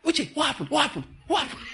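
hammed-up God pestering for details; mock fussy God. Preacher mocks the idea of a nosy God: high, fussing. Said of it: "It's not like".

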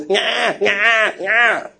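high whiny mock baby wail; imitated newborn cry. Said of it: "when you were born, it was".

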